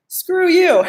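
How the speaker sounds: a high, light brush-off; telling the inner bully to get lost; playful mock defiance; defiant retort. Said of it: "self-love is about saying".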